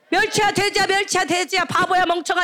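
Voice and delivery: high, run-on taunting; childish name-calling. Shown as a demonstration. Youth leaders teasing younger kids, childish name-calling. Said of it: "이렇게 하면 안 돼".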